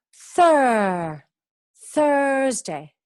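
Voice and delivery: "Thur" held loud with the er sound; modelling thur. Shown as a demonstration. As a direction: lean on the er in "Thur". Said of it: "teacher modelling er sound".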